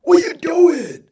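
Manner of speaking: high, loud mock shout in a Spanish accent; Spanish-accented imitation, high and slow holler; thick Spanish accent. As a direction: call someone out, loudly drawn out, thick Spanish accent. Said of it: "in her thick Spanish accent".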